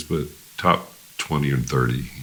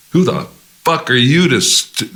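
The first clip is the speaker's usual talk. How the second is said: high, loud mock-furious challenge; retold angry outburst; 11 semitones higher, 6 dB louder than usual. Hag standing up to the label boss, acted angry outburst. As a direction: blow up at him, imitating an enraged man.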